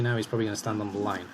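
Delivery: usual speaking voice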